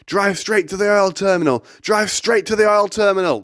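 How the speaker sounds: imitating a friend's high, urgent yell; insistent, high-pitched mock scream; frantic passenger giving orders, re-enacted; panicked screaming. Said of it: "Jake's screaming at me".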